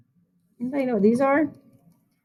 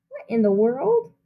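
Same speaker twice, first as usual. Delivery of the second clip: ordinary delivery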